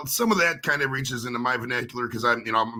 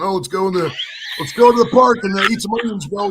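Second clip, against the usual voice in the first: mock onion bro; 8 semitones higher, 9 dB louder than usual. Mocking stoners at onion festival.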